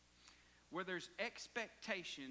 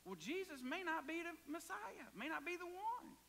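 tempting enemy voice; 9 semitones higher than usual. Acted. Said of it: "the enemy gets in his mind and says".

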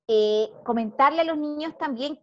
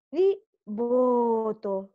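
exhale slowly; 4 semitones lower, much slower than usual. Shown as a demonstration. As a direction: stretch the words out slowly on a long breath out. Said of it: "breathing demo, exhale". (exhalando muy despacio)

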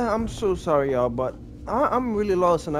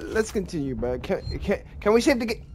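normal talking voice

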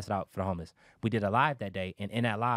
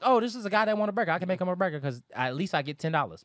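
mock eager thinking; 7 semitones higher than usual. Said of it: "That's how he was thinking about it".

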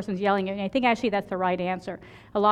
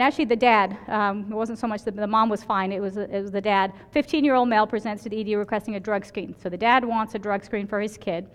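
normal talking voice

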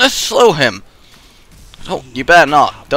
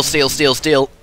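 usual speaking voice

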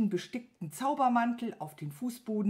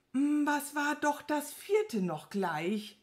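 slow, higher, drawn-out wondering; puzzled pondering; 6 semitones higher, slower than usual. A narrator's reading. Servant playing dumb to annoy the wizard, pondering. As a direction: dawdle as if searching your memory. Pretends to forget the fourth task.

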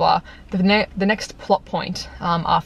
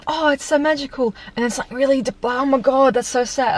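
mock gushing swings; 6 semitones higher than usual. Mocking the movie's mood swings.

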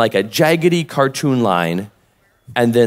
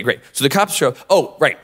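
ordinary talk, nothing marked

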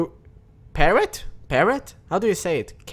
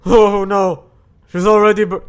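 normal talking voice

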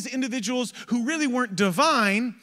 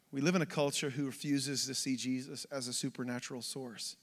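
soft, low, measured sermon voice; hushed preaching; 9 semitones lower, 10 dB quieter than usual. Real, not acted. Preacher on Jesus as only source, quiet and earnest. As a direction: bring it right down, earnest and low.

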